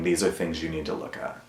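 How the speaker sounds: soft, near-whispered reminder; hushed self-encouragement; gentle self-talk. Real, not acted. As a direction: tell yourself gently under your breath. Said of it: "give myself the grace of saying".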